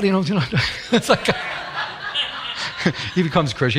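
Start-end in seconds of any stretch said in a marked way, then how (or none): 1.3-3.1 breathy laugh. Real, not acted. Laughs at the story.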